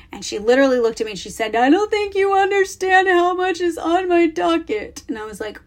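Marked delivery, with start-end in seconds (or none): 1.5-5.0 put-on high, overwhelmed kid's voice; imitating daughter. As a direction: play the dramatic ten-year-old, pitched up. Mom voicing daughter's complaint.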